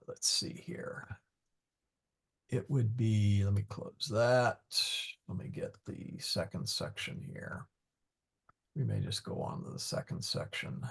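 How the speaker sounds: very soft, half-voiced mutter; absent-minded, barely voiced aside; muttering to oneself. Real, not acted. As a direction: think aloud under your breath while searching. Muttering while searching the slides.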